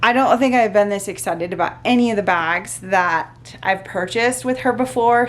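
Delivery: normal talking voice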